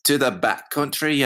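put-on second stab at Shrek's accent, pitched high; Shrek impression. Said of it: "Take, take two".

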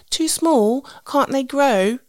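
put-on a small child who can't believe it; toddler voice. Daughter's reply voiced by mum.

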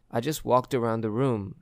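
plain reading voice

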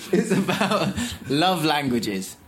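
slow words through a laugh. Real, not acted. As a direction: laugh out the embarrassing phrase. Embarrassed laugh over title.

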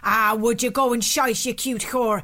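put-on Irish farmer, mildly indignant; Irish accent. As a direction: rebuff the buyer in an Irish accent. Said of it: "a phrase used in Ireland".

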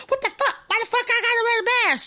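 hammed-up outraged lady demanding why she needs a mask. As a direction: squeal it out like a furious Target customer.

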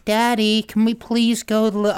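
put-on high-pitched child's American accent; little daughter's American accent. Dad imitating his daughter at play, high American accent. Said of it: "plays in an American accent".

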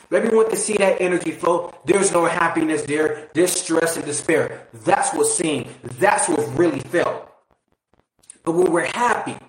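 ordinary delivery

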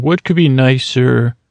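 ordinary delivery

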